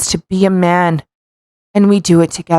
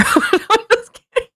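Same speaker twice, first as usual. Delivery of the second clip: laughing it off, high; giggling through the words; laughing at his own flub; 13 semitones higher than usual. Real, not acted.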